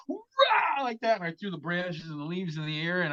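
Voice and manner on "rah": sudden high roar at full voice; roaring yell. Retold or imitated. Hiding man roars to scare off a bobcat, wild yell. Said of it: "I just went, ... I screamed as loud as I could".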